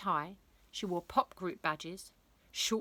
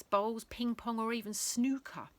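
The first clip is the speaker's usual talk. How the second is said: ordinary delivery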